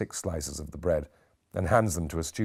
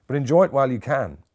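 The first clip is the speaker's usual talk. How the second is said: raised, higher, pointed warning to the room; ominous warning; 8 semitones higher, 8 dB louder than usual. Acted. Lecturer warning his students, loud and pointed. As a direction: lift it up and hit the room with it.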